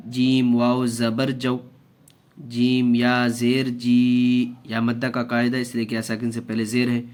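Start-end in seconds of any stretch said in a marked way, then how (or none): 2.4-4.5 जी held one alif long, slow and clear; drawn out vowel. Shown as a demonstration. Teacher showing students how to stretch, slowly. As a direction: stretch the जी for a full beat. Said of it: "एक अलिफ के बराबर खींच के पढ़ेंगे".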